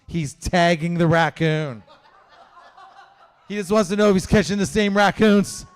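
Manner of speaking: usual speaking voice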